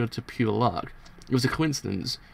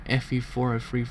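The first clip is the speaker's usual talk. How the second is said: ordinary delivery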